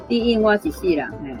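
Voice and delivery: usual speaking voice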